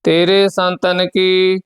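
steady, measured chant of the verses; scripture recitation. Acted. Teacher recites Gurbani verses for the students, steady and measured. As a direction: recite each line evenly, with devotion.

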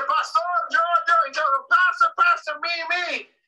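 put-on high, loud, eager little kid calling out; a five-year-old calling to the pastor, excited. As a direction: bounce like a child begging to be picked. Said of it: "levanta su mano lo más alegre".

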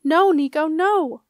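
loud, high pleading cry; yelled. A narrator's reading. Genna as Nico runs from the chamber, yelling. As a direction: yell after him to stop. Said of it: "Genna yelled".

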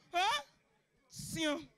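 mock sneeze, short and restrained; swaggering big-man sneeze. Comedian shows how big men sneeze, with swag. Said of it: "they sneeze with swag".

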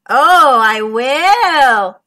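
loud, drawn-out villain sneer. A narrator's reading. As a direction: taunt them as the shark. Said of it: "sneered the shark".

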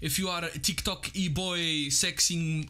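ordinary talk

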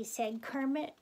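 ordinary delivery

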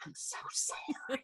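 imitating a hushed, whispered apology; mortified apology. Drive-thru worker sees the customer, mortified mock whisper. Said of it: "and they're like".

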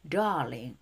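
DARLING drawn out on the AR; R sound. Shown as a demonstration.

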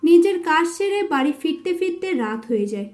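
ordinary delivery

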